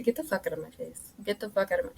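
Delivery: hushed, half-hearted telling-off; subdued mock outburst. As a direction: mutter the angry retort very quietly. Replays her outburst under her breath.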